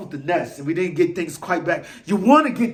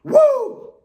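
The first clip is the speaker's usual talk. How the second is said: shrieked high woo; sudden high whoop; manic high woo; 7 dB louder than usual. Acted. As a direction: let out a frantic fan howl. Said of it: "Eagle Maniac a little bit worked up".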